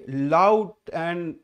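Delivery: loud, drawn-out emphasis. Shown as a demonstration. Teacher explaining what enthusiasm means, loud and stretched. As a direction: project it, stretching out the words. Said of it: "enthusiasm-টা মানে কি?".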